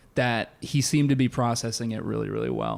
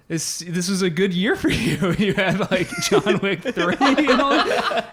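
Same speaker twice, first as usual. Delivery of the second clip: laughing through it; 6 semitones higher, slower than usual. Retold or imitated. Consoling a dying Keanu in dream.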